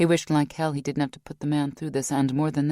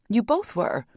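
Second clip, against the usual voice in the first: higher, faster, rising disbelief; Vanessa, upset; 7 semitones higher, 3 dB louder than usual. A narrator's reading. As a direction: push back, insisting he's not to blame.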